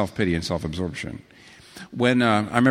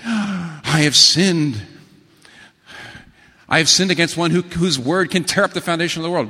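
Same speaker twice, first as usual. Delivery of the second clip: hammed-up dread of a sinner about to be punished; terrified confession; 4 semitones higher, 4 dB louder than usual. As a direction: cry out as if the ground may split.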